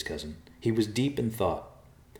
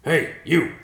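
much louder, higher shout up to the tree; calling out; 10 semitones higher, 10 dB louder than usual. Acted. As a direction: hail the vulture from below. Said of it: "Riverpaw called".

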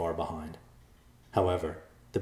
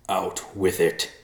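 growled; 4 dB louder, slower than usual. A narrator's reading. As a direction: drag the words out, fed up with waiting. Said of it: "Whiteclaw growled".

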